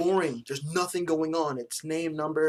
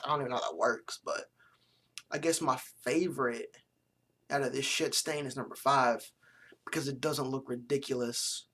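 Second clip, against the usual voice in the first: normal talking voice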